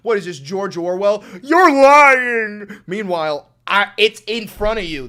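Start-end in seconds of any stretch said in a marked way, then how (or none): 1.4-2.8 shrill, very loud mocking yell; hammed-up outraged yell; mock accuser shout. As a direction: scream it like an indignant troll.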